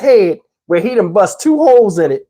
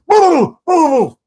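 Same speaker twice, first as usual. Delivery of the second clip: high hooted booming noises; drawn-out vocal booms; imitated boom; 8 semitones higher, slower than usual. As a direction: mimic big crashes with the voice. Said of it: "we hear this big boom".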